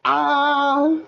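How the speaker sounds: high terrified scream; screaming in fear. Pretend. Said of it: "give me your best scream in terror".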